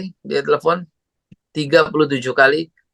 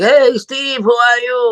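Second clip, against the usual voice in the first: much higher, loud cheery shout; overly upbeat morning caller; excited greeting; 10 semitones higher, 4 dB louder, slower than usual. Retold or imitated. Friend's cheery call retold.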